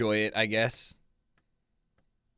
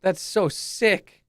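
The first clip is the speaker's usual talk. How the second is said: slow, pitched-up disbelief; stunned reaction to a stunt; amazed; 5 semitones higher, much slower than usual. Real, not acted.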